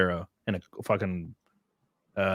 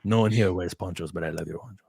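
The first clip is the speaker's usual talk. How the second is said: mock Mexican accent; 4 semitones lower than usual. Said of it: "I could even talk like that".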